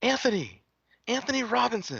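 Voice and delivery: high, calling out across the concourse; excited fan calling his name. Retold or imitated. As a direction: call his name from far across the room. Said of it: "I hear somebody go".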